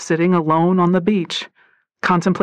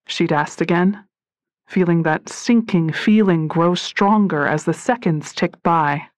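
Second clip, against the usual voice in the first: ordinary delivery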